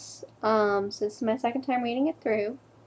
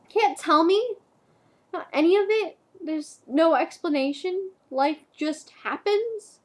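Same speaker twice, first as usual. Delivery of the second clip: incredulous anguish; 5 semitones higher than usual. Acted. Girlfriend demanding an explanation, incredulous anguish. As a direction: throw each question out in hurt disbelief.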